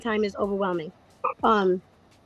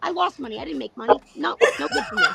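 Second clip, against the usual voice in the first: laughing rant; 6 semitones higher than usual. Retold or imitated. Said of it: "And then I'm like".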